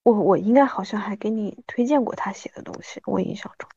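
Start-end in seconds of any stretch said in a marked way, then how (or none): none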